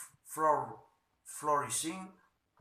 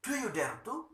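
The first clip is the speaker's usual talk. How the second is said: confronting; 9 semitones higher than usual. Acted. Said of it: "Going up to him".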